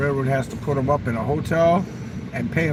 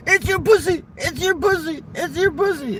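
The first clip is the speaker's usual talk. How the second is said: her voice; 14 semitones higher than usual. Retold or imitated. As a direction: mimic the woman crying it out in bed. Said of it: "and she go".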